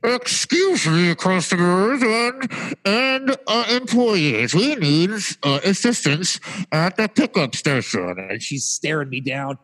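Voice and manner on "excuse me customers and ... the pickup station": slow, high, stretched-out store page; hammed-up furious paging, every word dragged out; imitated angry announcement. Angry clerk paging employees for pickup assistance, imitated anger. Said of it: "she's really angry at me".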